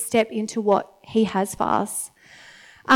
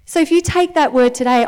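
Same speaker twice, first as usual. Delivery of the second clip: normal talking voice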